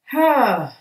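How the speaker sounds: loud exhaling sigh, falling from high; heavy sigh that slides down in pitch; big sigh. Real, not acted. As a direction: let out a big "hah" sigh. Winding up a long reading.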